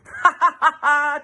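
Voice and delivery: high, loud mocking laughter; chortling kids. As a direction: laugh out the ha ha's at her. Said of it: "chortled the kids".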